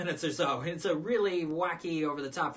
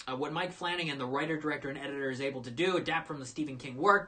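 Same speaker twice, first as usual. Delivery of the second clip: usual speaking voice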